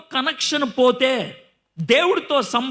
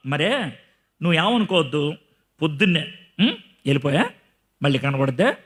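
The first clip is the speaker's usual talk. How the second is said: mock polite; 6 semitones lower than usual. Preacher imitating king politely dismissing David, mock courteous. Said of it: "అది కూడా ఎంత మర్యాదగా".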